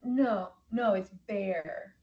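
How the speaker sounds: ordinary talk, nothing marked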